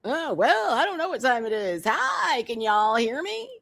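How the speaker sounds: sing-song mimicking of a streamer; teasing, exaggerated echo; mocking imitation. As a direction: mimic a fumbling greeting, pitch swooping up and down. Mimics the streamer's fumbling greeting.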